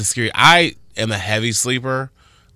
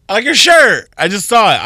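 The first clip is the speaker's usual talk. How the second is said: excited; 9 semitones higher, 9 dB louder than usual. Real, not acted. Sudden noticing of shirt.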